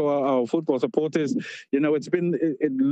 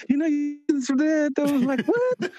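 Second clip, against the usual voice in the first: shocked reply; 11 semitones higher than usual. Retold or imitated. Uncle's call, shocked reply.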